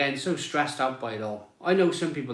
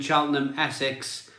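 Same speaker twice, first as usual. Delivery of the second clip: ordinary delivery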